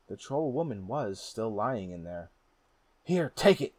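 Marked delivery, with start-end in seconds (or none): 3.0-3.7 loud, high angry shout. A narrator's reading. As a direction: throw the words at her. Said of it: "he shouted at her".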